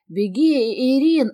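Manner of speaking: mild urgent cry, lifting on the name. A narrator's reading. Бэк warns the heroine to flee, crying out. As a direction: call out a warning to run. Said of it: "закричал мне".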